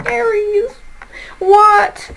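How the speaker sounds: high, incredulous rising exclamation; real astonishment. Real, not acted. Tarot reader astonished at Aries' cards, high disbelief.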